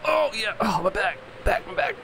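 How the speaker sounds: put-on high creaky old man complaining about his back; old man groaning. Pretend old man climbing off table.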